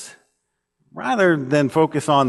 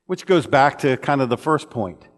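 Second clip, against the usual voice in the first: ordinary talk, nothing marked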